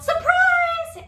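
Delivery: high, loud party shout; playful shouted surprise; mock surprise yell. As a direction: yell it like springing a birthday surprise. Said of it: "someone just yelled".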